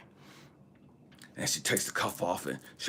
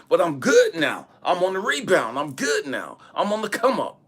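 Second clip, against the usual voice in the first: high, punchy retold protest; emphatic insistence; 8 semitones higher, 6 dB louder than usual. Patient insisting he is on the rebound, emphatic high protest. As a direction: drive each claim home, pitched up.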